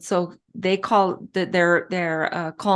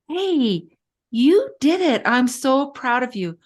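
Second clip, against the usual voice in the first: proud praise; 5 semitones higher than usual. Retold or imitated. Dad praising proud son.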